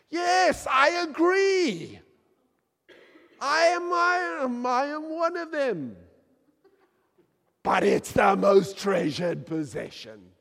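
loud, high impassioned shouting; passionate shout. Real, not acted. Preacher shouting his love for the church, passionate. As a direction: shout it out with full conviction. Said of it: "Sorry, I get too passionate".

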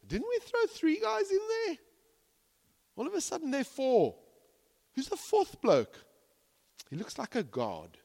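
a king who can't believe his eyes; the king staring into the furnace in amazement; astonished king. Acted. King sees a fourth man.

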